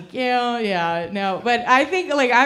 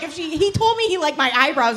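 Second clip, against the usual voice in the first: higher, excited mock gushing; imitated gushing women; 6 semitones higher than usual. Comic mimics women thrilled by compliment, mock gushing. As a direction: gush over a tiny compliment. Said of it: "You all are like".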